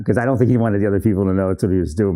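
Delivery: ordinary delivery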